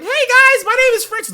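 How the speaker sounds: put-on high, loud squeaky boy greeting; squeaky kid voice. Streamer imitating his squeaky voice before puberty. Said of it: "I was like".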